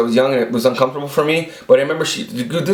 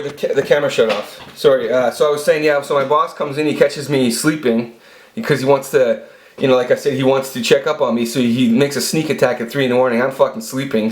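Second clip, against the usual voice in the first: ordinary talk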